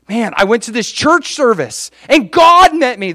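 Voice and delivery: high-pitched, eager news-breaking; excited testimony. Acted. Telling a stranger at gas station, excited testimony. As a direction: blurt out the amazing news.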